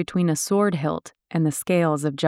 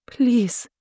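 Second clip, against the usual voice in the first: high pleading please; mild narrated begging; 7 semitones higher than usual. A narrator's reading. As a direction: beg him one last time. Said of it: "Laura begged".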